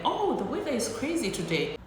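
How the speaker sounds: high, singsong small-talk voice; chatty American. Acted. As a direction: imitate an American making breezy small talk. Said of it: "have an American person go like".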